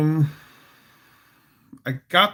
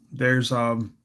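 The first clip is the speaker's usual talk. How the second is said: usual speaking voice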